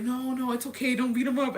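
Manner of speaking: acted-out hasty reassurance; mock pleading. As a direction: talk him down, voice lifted. Retells talking dad out of fighting.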